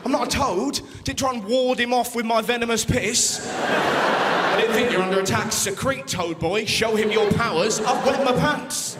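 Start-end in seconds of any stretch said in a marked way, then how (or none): 3.5-9.0 slow sneering brother; mocking brother. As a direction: drag it out to mock him.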